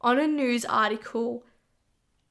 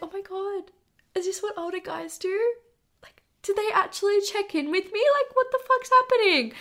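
higher-pitched amazed questioning; incredulous; 7 semitones higher than usual. Retold or imitated. She recalls reacting to an older guy checking in, incredulous. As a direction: retell it in astonishment, pitched up. Acted inner reaction to his message.

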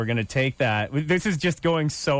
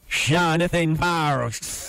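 slow garbled reversed-playback voice; played back in reverse, odd accent; reversed audio; 2 semitones higher, much slower than usual. Shown as a demonstration. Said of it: "pop it in reverse".

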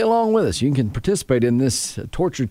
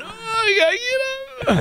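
strained groan. Retold or imitated. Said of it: "When you're going".

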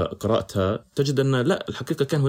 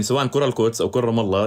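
usual speaking voice

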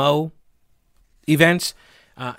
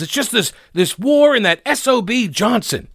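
mock angry quote, higher pitch; quoted movie rant; 7 semitones higher than usual. Host quoting the Forrest Gump boyfriend, mock rant. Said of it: "That's the quote".

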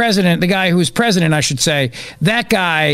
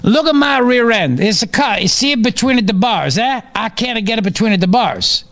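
higher-pitched comic Italian prisoner; mock Italian accent; 5 semitones higher than usual. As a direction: whine your case in hammed-up Italian English. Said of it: "fat ass Italian who was released from prison".

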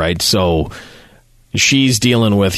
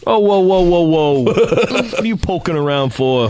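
put-on New York accent; 7 semitones higher than usual. Joining New York bee bit.

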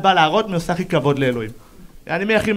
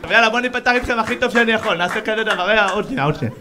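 normal talking voice

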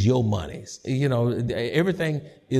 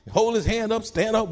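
ordinary talk, nothing marked

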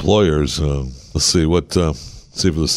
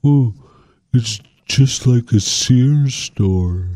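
mocking gushing voice; 4 semitones higher, much slower than usual. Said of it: "until I heard".

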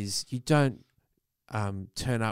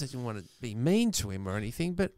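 ordinary talk

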